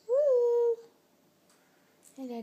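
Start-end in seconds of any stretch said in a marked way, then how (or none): 0.1-0.8 high excited woo; excited squeal. Real, not acted. Cheering baby on.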